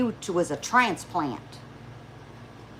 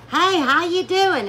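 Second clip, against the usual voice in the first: high, slow, louder friendly hello; cheery greeting; 8 semitones higher, 4 dB louder than usual. Retold or imitated. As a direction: greet a stranger with big sunshine. Her friendly greeting to strangers.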